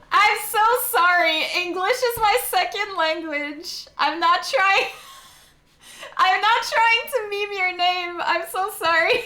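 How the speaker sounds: high, giggly apology through laughter; can't stop laughing while apologising. Real, not acted. Laughing as she apologises to a viewer for mangling his name.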